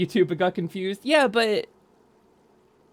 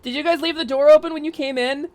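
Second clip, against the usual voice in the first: ordinary talk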